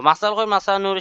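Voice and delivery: emphatic demo. Shown as a demonstration. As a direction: lift the whole greeting up, stressing Khayer. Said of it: "আমরা উত্তর বলবো".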